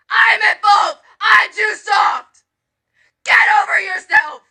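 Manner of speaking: crying, shouting out in anguish; pleading and furious through tears; real tearful anger. Real, not acted. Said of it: "I am angry".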